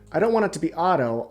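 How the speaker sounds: ordinary talk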